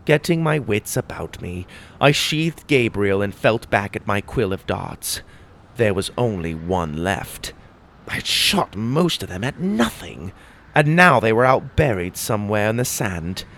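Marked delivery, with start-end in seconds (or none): none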